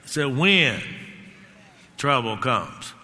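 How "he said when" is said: when leaned on, falling firmly; stress on when. Shown as a demonstration. Preacher to congregation, pressing the word when. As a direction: hold the word when so it lands. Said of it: "I want you to notice he says when".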